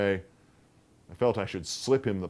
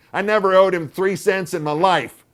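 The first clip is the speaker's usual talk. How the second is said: put-on high, loud, tart old aunt; sharp, offended retort; 10 semitones higher, 11 dB louder than usual. A narrator's reading. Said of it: "she said tartly".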